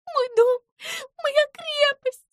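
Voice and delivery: high squeaky little mouse, overjoyed; joyful squeak. A narrator's reading. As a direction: squeak it out in delight like a tiny mouse. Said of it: "обрадованно запищала Пимпочка".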